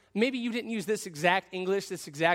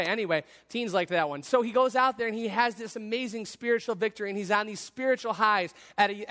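ordinary delivery

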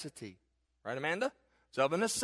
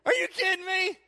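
surprised disbelief; 12 semitones higher, 5 dB louder than usual. Acted. Pastor mocks his own surprise.

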